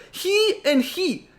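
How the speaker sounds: very high, slow mock-child accusation; limited-English boy. Boy with little English points out two culprits, high and slow. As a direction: draw out each word in a squeaky imitation. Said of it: "His English was very limited".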